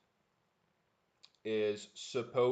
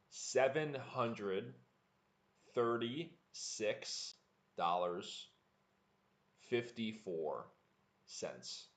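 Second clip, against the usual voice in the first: slow, word by word, each number set apart; slow practice read; slower than usual. Shown as a demonstration. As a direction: spell the price out slowly for learners. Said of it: "Just to review the number for practice".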